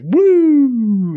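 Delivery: long, high, loud "woo"; gleeful whoop. Retold or imitated. Penguins sliding on their bellies, high gleeful whoop. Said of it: "they're like".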